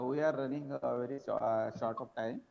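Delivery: faint, far-off voice; almost too quiet to hear; barely audible. Real, not acted. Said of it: "I was not able to hear".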